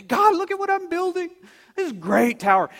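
high, eager show-off voice; boastful tower builder. Acted. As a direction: call up proudly for God's attention. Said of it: "want God to be impressed".

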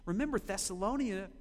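usual speaking voice

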